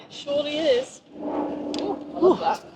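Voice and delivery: high, giddy delight ending in a laugh; real glee, then a laugh. Real, not acted. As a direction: gush over the find. Delighted by a find.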